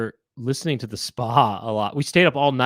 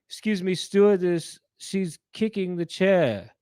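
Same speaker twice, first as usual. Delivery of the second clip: slow, higher, put-on tattling voice; snooty complainer; 5 semitones higher, slower than usual. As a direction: tell on the kid to the stewardess, slowly. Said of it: "He would go".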